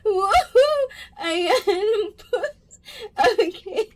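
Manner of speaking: high, teary laughter through an apology; real tears of laughter; crying with laughter. Real, not acted. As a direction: apologize while helplessly laughing. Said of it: "I can't stop laughing".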